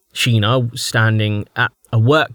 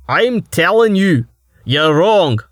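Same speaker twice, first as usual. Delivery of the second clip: loud angry shout, higher and slow at first; 7 semitones higher, 5 dB louder, slower than usual. Acted. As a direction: yell it at him in the heat of the argument. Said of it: "Starvo shouted".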